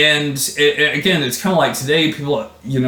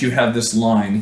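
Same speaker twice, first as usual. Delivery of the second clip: ordinary talk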